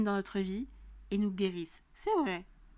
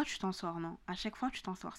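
ordinary delivery

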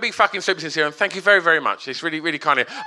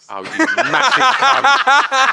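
slow, laughing jab at a mate; laughing insult; 8 semitones higher, 5 dB louder, much slower than usual. Real, not acted. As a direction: drag it out through a laugh. Mock insult at friend, laughing.

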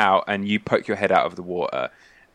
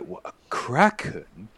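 slow, incredulous question; stunned sailor repeating the news; shocked disbelief; 3 dB quieter, slower than usual. Acted. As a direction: draw out the words in mild disbelief. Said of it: "he's shocked".

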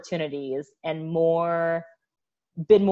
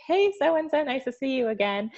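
friendly greeting; 8 semitones higher than usual. Retold or imitated. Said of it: "It's like".

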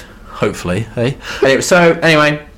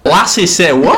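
higher, laughing Scottish accent; mock Scottish accent; 6 semitones higher, 3 dB louder than usual. Shown as a demonstration. Co-host riffing on the phrase, laughing mock Scottish. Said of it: "say that with a Scottish accent".

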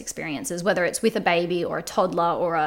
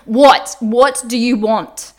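loud, higher-pitched angry outburst; retold angry yell; 4 semitones higher, 12 dB louder, much slower than usual. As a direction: re-enact losing your temper, drawing out the question. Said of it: "it was definitely in anger".

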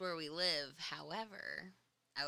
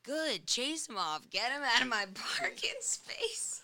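higher, slower, gleeful urging; egging her on; 8 semitones higher, 5 dB louder than usual. Retold or imitated. Shop owner urging her to chase him off, gleeful. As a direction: cheer her on like a gleeful ally. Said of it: "And you were like".

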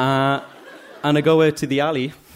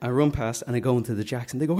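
ordinary delivery, nothing marked

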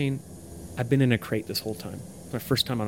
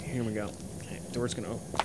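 partners planning an ambush, hushed; soft, secretive undertone; hushed plotting; 7 dB quieter than usual. Pretend. Two schemers by the door, hushed plotting.

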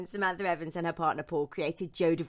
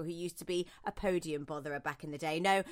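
normal talking voice

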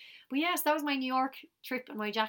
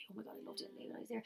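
whisper; 14 dB quieter, much faster than usual. Real, not acted. Gushing about LA.

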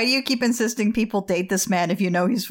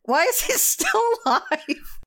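very high, slow mock lament; high comic anguish; exasperated wail; 11 semitones higher than usual. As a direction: wail it in drawn-out disbelief. Mock despair at Wilbur's survival.

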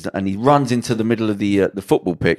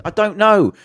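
high, louder helpless outburst; retold exasperation; 13 semitones higher, 6 dB louder, slower than usual. Him answering his mates in the pub, exasperated and raised. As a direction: act out someone pushed past his limit.

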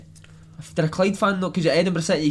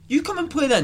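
retold retort; 10 semitones higher than usual. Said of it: "I was like".

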